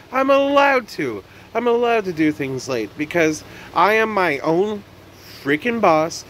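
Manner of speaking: hyping himself up about being his own boss; defiant self-pep. Real, not acted.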